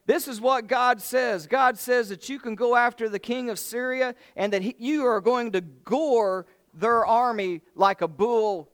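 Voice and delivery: hammed-up prophecy, pitched high and loud; mock prophet proclaiming. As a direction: boom it out like a self-important prophet. False prophet proclaims victory.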